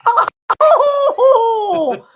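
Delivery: long high "oh" howl; high loud shriek. Real, not acted. Host exasperated by the puppet, high playful shriek.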